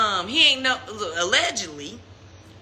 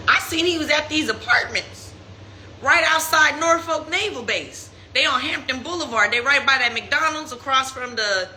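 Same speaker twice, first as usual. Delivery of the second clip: ordinary talk, nothing marked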